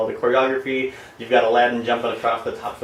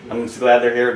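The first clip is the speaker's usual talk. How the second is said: ordinary delivery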